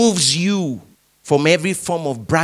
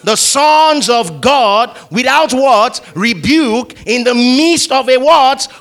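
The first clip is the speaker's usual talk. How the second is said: loud, high, slow lead reading; preacher calling out the verse; call-and-response reading; 7 semitones higher, 6 dB louder than usual. Shown as a demonstration. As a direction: lead the crowd line by line. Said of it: "Together, everybody".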